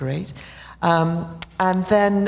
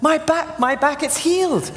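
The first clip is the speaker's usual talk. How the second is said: much higher, joyful retold exclamation; overjoyed cry; 12 semitones higher than usual. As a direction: cry it out like she's jumping for joy. Jumping up and down saying.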